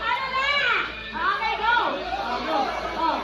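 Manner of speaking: high-pitched excited calling out; excited shouting. Real, not acted. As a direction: cheer the players on, high. Host calling out a running game.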